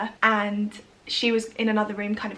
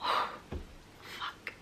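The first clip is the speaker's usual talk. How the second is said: whispered, much softer curse through tears; breath-heavy swear while crying; real tearful curse; 13 dB quieter than usual. Real, not acted. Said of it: "why am I crying".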